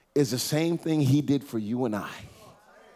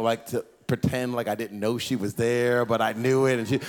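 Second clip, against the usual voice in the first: ordinary talk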